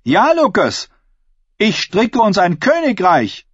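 high-pitched, forceful oratory to a hall; defiant female claimant. Acted. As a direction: challenge the crowd like a contender for the crown. Asha retorts to heckler.

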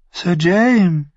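Varys gasps high and startled; gasped. Acted. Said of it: "keuchte Varys". (gekeucht, hoch und erschrocken)

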